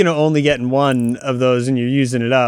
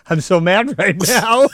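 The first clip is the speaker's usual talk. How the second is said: laughing; 7 semitones higher than usual. Retold or imitated. Wife laughing while saying mad.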